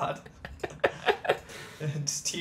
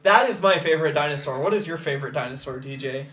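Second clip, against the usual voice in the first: fast; 4 dB louder, faster than usual. Pretend. Fast mock answer.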